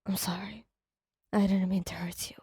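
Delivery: hushed whispered apology; a whispered apology to his hurt partner. Acted. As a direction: barely voice the sorry. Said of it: "he said".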